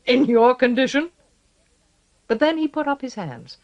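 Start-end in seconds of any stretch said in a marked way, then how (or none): 0.0-1.1 rough, loud guard voice; sneering gruff guard. A narrator's reading.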